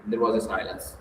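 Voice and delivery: ordinary delivery, nothing marked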